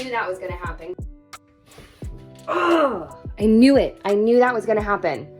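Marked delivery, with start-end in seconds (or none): 2.5-5.3 frustrated. Real, not acted. Piece fails again.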